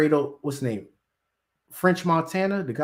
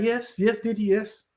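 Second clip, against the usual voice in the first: high, eager "yes, yes" pitched up; mock eager yes-man; 7 semitones higher than usual. [name] answering [name]'s call, mock eager. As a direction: play the eager servant jumping to answer. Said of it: "You be like".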